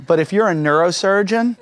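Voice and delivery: normal talking voice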